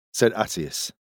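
ordinary delivery

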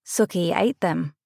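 level, understated confession; deadpan, contained delivery. A narrator's reading. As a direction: say it evenly, without reaction. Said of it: "I say levelly".

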